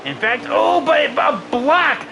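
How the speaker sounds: high, loud excited outburst; stretched-out cry of surprise at the block. Real, not acted. Sudden block in the race.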